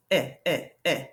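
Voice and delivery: short "eh, eh, eh" in separate bursts; sounding out. Shown as a demonstration. Teacher showing a short E sound, sounding it out. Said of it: "That is a short E sound".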